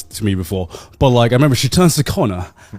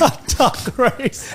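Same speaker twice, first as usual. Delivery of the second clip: slow, drawn-out, higher echo of the phrase; mock disbelief; 8 semitones higher, 4 dB quieter, much slower than usual. Friend echoing the duck race news, slow amused disbelief. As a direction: savour each word in disbelief.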